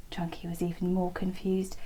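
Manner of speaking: ordinary delivery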